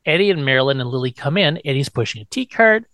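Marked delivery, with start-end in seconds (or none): none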